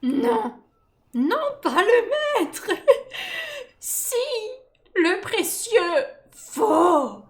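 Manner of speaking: high, drawn-out creature pleading and warning; stretched, high-pitched creature argument; Gollum. Acted. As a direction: swing between high protest and slow warning.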